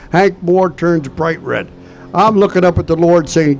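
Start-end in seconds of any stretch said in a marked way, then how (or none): none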